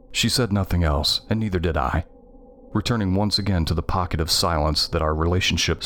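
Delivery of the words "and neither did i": plain reading voice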